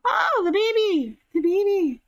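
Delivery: high, gushing delight. Real, not acted. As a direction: melt at the sight of a newborn. Cooing over the newborn game baby.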